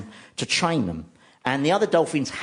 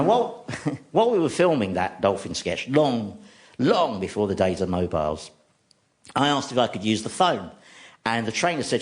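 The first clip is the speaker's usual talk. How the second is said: ordinary talk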